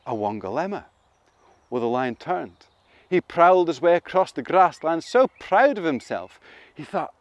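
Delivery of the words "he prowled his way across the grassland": usual speaking voice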